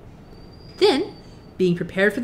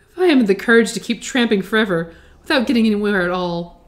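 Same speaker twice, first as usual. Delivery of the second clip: put-on cowardly lion voice, louder; whimpering; 5 dB louder than usual. A narrator's reading. Cowardly Lion losing courage to keep tramping, whimpering. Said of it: "said the Cowardly Lion with a whimper".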